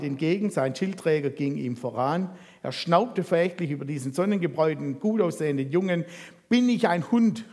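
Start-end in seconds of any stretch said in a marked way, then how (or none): 6.5-7.4 scornful shout. A narrator's reading. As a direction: sneer it out at the boy. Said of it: "rief er zu David".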